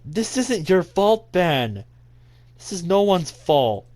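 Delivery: high, loud, pleading insistence; emphatic distress. Acted. Natsuki telling Ben it isn't his fault, distressed.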